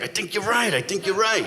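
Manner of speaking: retold excited partner; excited agreement. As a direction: imitate a worked-up partner agreeing.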